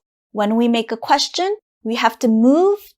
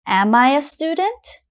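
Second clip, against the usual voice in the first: "Am I a student" rising high to the end; teacher's rising question tune on "Am I a student"; question intonation; 4 semitones higher than usual. Shown as a demonstration. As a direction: ask "Am I a student" with pitch climbing through "student". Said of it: "my intonation goes up for the question".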